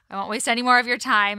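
quick rush to wrap up; fast. Real, not acted. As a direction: hurry through it. Hurrying to the guest.

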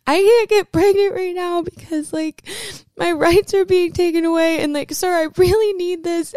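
high, loud, strained crying voice; imitating her own crying plea. Retelling how she begged the pharmacist in tears, high crying voice. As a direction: mimic a tearful plea to the pharmacist, breaking and high. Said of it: "start crying".

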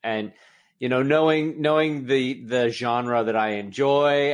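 normal talking voice

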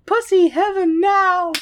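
very high, loud, drawn-out squawk; silly parody voice. Acted. Host riffing on the lovers for laughs, loud high parody voice. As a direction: stretch it out in a ridiculous high voice.

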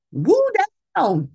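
squeaky high Woo then down; Prince Wu impression. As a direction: play the cartoon prince. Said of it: "he was like".

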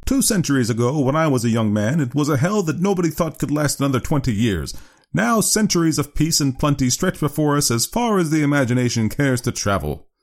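high oratorical doctor voice, settling at the end; visionary doctor. Acted. Doctor praising centuries of peace, high and idealistic. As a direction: proclaim the bright future in a lifted voice.

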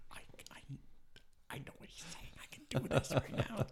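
hushed, strained whisper to himself; whispered inner voice. Retold or imitated. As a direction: whisper it, barely holding back. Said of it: "me like".

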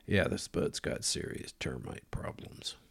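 whispered softly, leaning in close. Retold or imitated. Guy leaned over to whisper.

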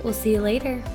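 ordinary talk, nothing marked

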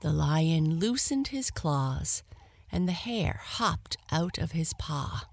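ordinary delivery

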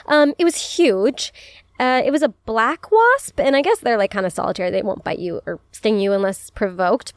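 normal talking voice

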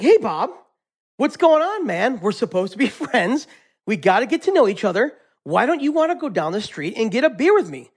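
a friend at his wits' end who still wants that beer; mock-frustrated guy demanding his caterpillar come out; frustrated buddy.

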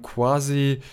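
usual speaking voice

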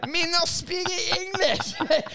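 drawn out mock broken English line; slow high mock broken English; broken English put-on. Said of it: "and then say".

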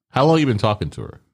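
ordinary talk, nothing marked

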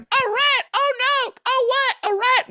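very high squeals of alarm; mock panic. Startled crowd watching the rat, high squeals. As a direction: squeal like a crowd of frightened ladies. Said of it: "the people were like".

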